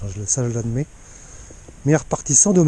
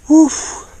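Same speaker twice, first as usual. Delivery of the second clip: sharp high yelp of relief; high relieved whoop; 12 semitones higher, 6 dB louder than usual. Real, not acted. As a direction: let out a loud high "ouf". Landing the fish, relief.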